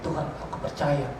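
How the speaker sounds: low, soft retold prayer to God; hushed, reverent plea; quiet prayer. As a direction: pray it softly and low, in trust. Retells own prayer to God.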